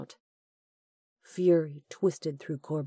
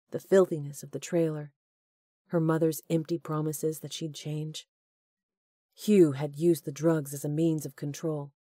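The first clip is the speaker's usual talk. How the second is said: usual speaking voice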